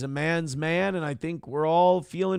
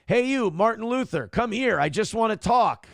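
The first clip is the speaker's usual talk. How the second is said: a lightly raised, coaxing call; mock-friendly lure, a touch higher; 6 semitones higher than usual. Beckoning Martin Luther over to talk, mock friendly.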